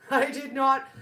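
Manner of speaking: usual speaking voice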